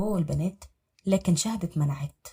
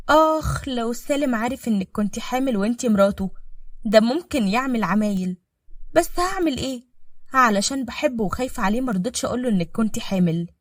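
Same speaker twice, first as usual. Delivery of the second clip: a touch higher, louder sly woman; lightly voiced scheming wife; 5 semitones higher, 5 dB louder than usual. Wife taunting the maid in the kitchen. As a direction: needle her with fake sweetness.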